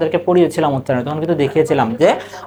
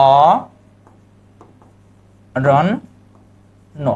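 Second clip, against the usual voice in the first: অ, রণ, ন with long gaps between; drawn-out অ, then রণ and ন apart; syllable by syllable; 3 semitones lower, much slower than usual. Shown as a demonstration. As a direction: dictate অ, রণ, ন one piece at a time. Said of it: "আমরা এই উচ্চারণ লিখলাম".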